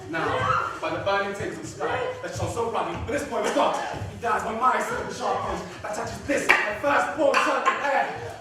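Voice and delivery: Aaron threatening the brothers to guard his son; furious threat. Acted. Aaron protects child, threatens brothers.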